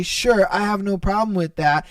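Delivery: ordinary delivery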